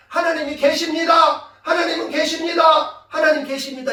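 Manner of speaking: loud, high repeated declaration; defiant shout. Retold or imitated. Believing child answering the class, imitated shout. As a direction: shout it out, easing on the last. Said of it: "이렇게 외쳐버렸죠".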